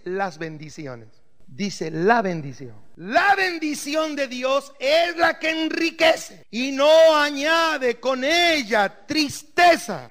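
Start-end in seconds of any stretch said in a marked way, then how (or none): 3.0-10.1 preaching. Acted. Repeats scripture slowly, preaching.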